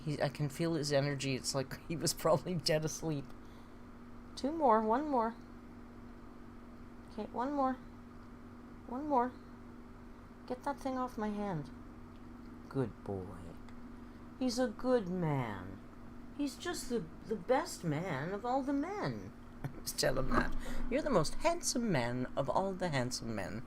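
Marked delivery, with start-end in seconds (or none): 4.3-11.6 high, sing-song counting treats; pet talk. Real, not acted. Owner counting out treats to her pet, high coo. 14.4-19.3 high, slow, soft doting; doting praise. Real, not acted. Owner praising her pet as best man, slow doting coo.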